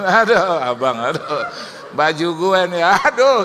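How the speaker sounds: loud, high-pitched mock wail; exaggerated agonised groaning over a loss; comic dismay. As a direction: lament it loudly, hamming up the distress. Comic dismay seeing his shirt worn.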